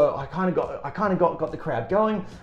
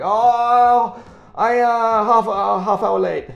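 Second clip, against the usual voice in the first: high, loud, halting put-on voice; imitating late entertainer; 11 semitones higher, 6 dB louder, much slower than usual. Late entertainer phones in, high halting mimic. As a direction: stumble like someone short on English.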